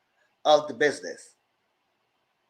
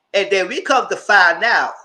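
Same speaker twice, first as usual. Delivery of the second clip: usual speaking voice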